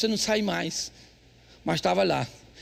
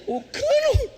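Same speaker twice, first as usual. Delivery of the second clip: high, laughing punchline; cracking up at the punchline; laughing disbelief; much slower than usual. Retold or imitated. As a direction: squeal it through a laugh. Punchline, wife fixing pipe.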